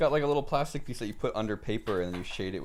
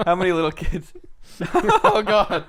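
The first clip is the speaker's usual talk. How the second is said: laughing; 8 semitones higher, 8 dB louder than usual. Real, not acted. Cracking up at own joke.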